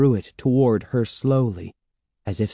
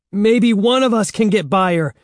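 high, loud, desperate call; raised, panicky voice; urgent cry; 11 semitones higher, 6 dB louder than usual. A narrator's reading. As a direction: cry out the idea in alarm. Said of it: "Evan cried".

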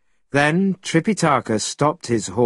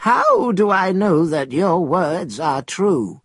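put-on higher-pitched doubting old man; 4 semitones higher than usual. A narrator's reading. Old man Chen questioning the stranger, old man's voice. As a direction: question it as a wary elder. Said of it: "said the old man, whose name was Chen".

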